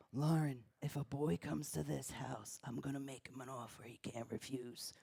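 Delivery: low, soft Godfather rasp; dad doing a Godfather voice; mobster dad impression. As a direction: menace her like a mafia don, quietly. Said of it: "the type of dad that would be like".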